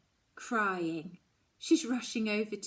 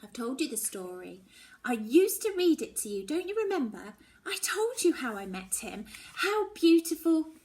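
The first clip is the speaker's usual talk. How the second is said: mother insisting; 5 semitones higher, 7 dB louder than usual. Acted. Mum defends her story.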